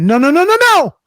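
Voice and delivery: high, loud cry of alarm; mock-horrified wail after a fatal mistake; retold panic. Retold horror after hitting send.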